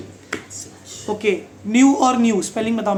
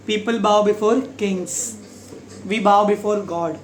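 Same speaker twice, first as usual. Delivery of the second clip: very slow, bow said like bau; slow example sentence; much slower than usual. Shown as a demonstration. Teacher slowly modelling bow as bend. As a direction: lay it out slowly, solemn. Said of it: "बाव".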